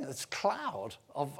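ordinary talk

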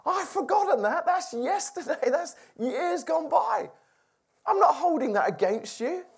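a hint of Joseph, high and dismissive of old wrongs; Joseph forgiving. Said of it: "he thought".

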